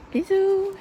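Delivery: squeaky high sign-off; cutesy high bisous. Acted. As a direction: chirp it high and cute. Sign-off kiss.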